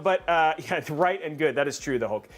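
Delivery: ordinary delivery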